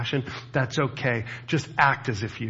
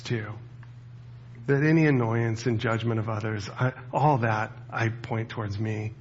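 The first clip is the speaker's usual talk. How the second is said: ordinary talk, nothing marked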